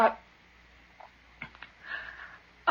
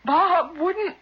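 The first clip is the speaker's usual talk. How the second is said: alarmed; 7 semitones higher, 3 dB louder than usual. Acted. Sudden fearful realisation.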